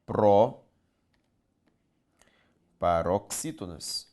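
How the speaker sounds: proparoxítonas stretched very slowly syllable by syllable; drawn out. Shown as a demonstration. Teacher spelling out the term for students, slowly. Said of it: "justifica-se na regra das".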